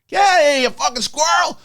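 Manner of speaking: high, loud startled yell; screaming at a squirrel. Retold or imitated. On a work call, yelling at the candy-stealing squirrel, loud and high. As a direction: bellow at the thief mid-call. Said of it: "I start screaming at a squirrel".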